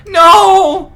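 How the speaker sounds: very high, very loud cry of denial; anguished shout. Real, not acted. Gamer refusing to accept Gundam's death, anguished shout. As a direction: throw the no out in anguish.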